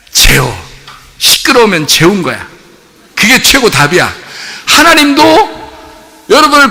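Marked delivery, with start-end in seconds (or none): none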